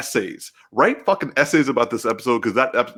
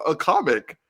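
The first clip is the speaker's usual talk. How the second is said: ordinary delivery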